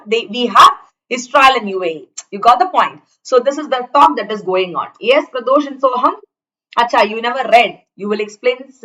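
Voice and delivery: ordinary talk, nothing marked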